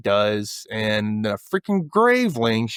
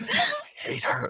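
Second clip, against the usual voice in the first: mock low mutter, not a whisper; imitating a low catty aside; 7 dB quieter than usual. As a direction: say it low, near enough to be heard. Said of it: "It's just low".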